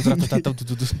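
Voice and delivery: imitating a drum beat; drum sounds with mouth. Shown as a demonstration. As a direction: tap out drum beats vocally. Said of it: "Fico fazendo isso o dia inteiro".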